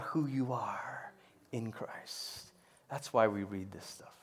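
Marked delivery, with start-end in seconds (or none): 0.0-2.5 voiceless whisper, much softer; a hushed Father voice trailing off; whispered promise. Acted. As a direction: breathe it like a secret to a child.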